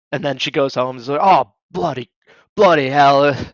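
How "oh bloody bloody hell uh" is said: hammed-up English actor; mock English accent. Actor home after faking an accent, mock English. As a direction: put on English, weary after work. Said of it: "secretly an English actor".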